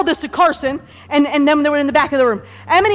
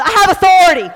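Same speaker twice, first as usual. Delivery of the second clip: raised, forceful rallying call; emphatic declaration; 6 semitones higher, 6 dB louder than usual. Shown as a demonstration. Leading congregation in repeating it, emphatic.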